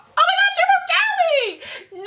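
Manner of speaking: high, drawn-out mock squeal; excited mimicry. Imitates people hearing American accent.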